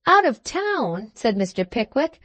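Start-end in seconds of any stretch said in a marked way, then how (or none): 0.1-1.1 high, slow dismayed question; Pickwick's drawn-out startled question; surprised. Acted. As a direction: catch the news with startled dismay.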